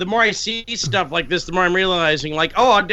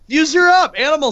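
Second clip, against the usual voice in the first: excited; 10 semitones higher, 6 dB louder than usual. Real, not acted. Hyping views, joking.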